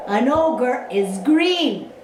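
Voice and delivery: plain reading voice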